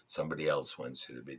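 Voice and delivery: ordinary talk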